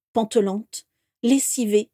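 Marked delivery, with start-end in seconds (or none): none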